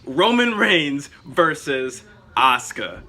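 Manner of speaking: slow, stretched arena announcer call; drawn-out wrestling matchup announcement; ring announcer matchup. Pretend.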